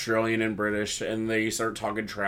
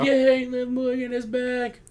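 high, slow squealed "Yay"; hammed-up delighted squeal; mock cheer; 12 semitones higher, slower than usual. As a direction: cheer it like a thrilled fan, pitched way up. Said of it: "they're like".

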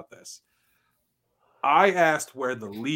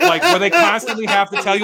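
laughing rant; 10 semitones higher, 12 dB louder than usual. Real, not acted.

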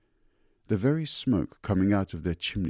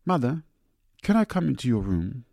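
high, louder call to Mother; 7 semitones higher, 6 dB louder than usual. Acted. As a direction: call through the door to Mother. Calling to mother through door.

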